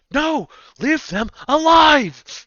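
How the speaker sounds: high, slow pleading cry; desperate shout. Acted. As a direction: cry out to stop them. GM voices Kaio commanding.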